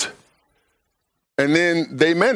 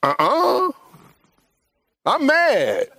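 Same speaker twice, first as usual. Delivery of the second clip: stubborn refusal; 12 semitones higher, much slower than usual. Retold or imitated. Preacher acting out stubborn refusal.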